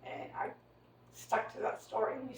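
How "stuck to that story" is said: ordinary delivery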